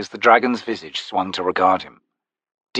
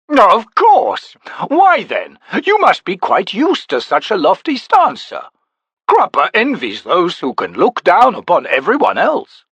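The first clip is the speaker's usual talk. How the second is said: much higher, gushing chatter; fussy little Crupper; 9 semitones higher, 3 dB louder than usual. A narrator's reading. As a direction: flatter the tall stranger eagerly. Crupper's voice again.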